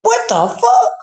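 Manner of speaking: high, yelled disbelief; retold stunned, yelled reaction in a high voice; shocked outburst. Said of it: "I'm like".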